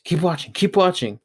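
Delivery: usual speaking voice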